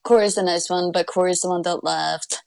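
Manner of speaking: normal talking voice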